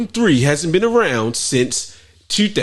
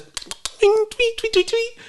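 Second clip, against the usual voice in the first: bird tweet; much slower than usual. Retold or imitated. Imitating birds of paradise.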